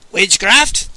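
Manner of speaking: loud accusing boom. Acted. Pope hearing of a charm, loud accusing boom. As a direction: pounce on the word. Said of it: "the Pope boomed".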